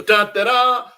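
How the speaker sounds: high, loud announcer-style drumroll call; hammed-up fanfare. Host heralds a Superman chat from a listener, hammed-up fanfare.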